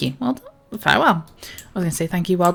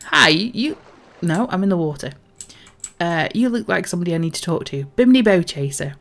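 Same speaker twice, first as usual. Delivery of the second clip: ordinary talk, nothing marked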